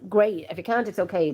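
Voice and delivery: ordinary talk, nothing marked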